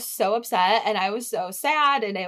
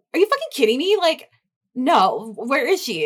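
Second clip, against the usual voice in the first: louder, indignant outburst; retold angry confrontation, raised voice; indignant retort; 4 semitones higher, 6 dB louder than usual. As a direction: demand answers in disbelief. Retells confronting partner over lost dog.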